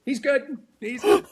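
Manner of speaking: high, loud exclaiming doctor voice; relieved doctor. Retold or imitated. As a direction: announce the patient is fine, high and bright. Doctor voiced in retold story.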